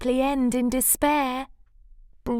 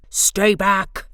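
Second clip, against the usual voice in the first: louder warning bark; shout; 6 dB louder than usual. Acted. Bruce shouting Cyril away from the cone. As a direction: shout him off. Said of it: "shouted Bruce".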